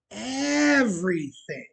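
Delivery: "Everything" stretched very slowly, high then falling; emphatic repetition. Real, not acted. Repeating how much she gave us, emphatic drawn-out stress on "Everything".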